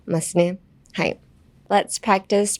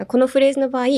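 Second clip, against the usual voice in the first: normal talking voice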